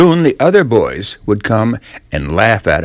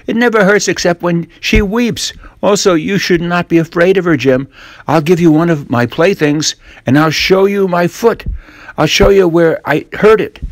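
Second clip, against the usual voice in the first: put-on higher boyish voice, eager bribing; sly young Tom; 7 semitones higher than usual. Tom tempting Jim with playthings, eager boyish voice. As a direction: tempt him like a cunning boy.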